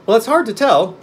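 high, loud put-on voice; pumpkinhead. A narrator's reading. Newborn pumpkin man answering the witch, high and loud. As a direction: answer gravely in a high character voice. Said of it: "replied Jack".

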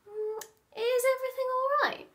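high, fast worried question; Daddy fretting; anxious Daddy. Acted. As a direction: nervously hurry it out.

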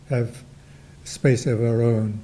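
ordinary talk

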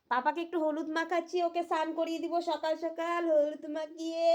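high, cooing parent voice. Real, not acted. Cooing to child during turmeric rubbing.

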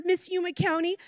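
usual speaking voice

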